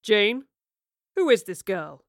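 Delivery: man's loud, commanding questioning; deep demanding voice. Acted. Man questioning his wife about a stranger, demanding. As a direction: demand answers, loud and firm. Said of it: "a deep voice demanded".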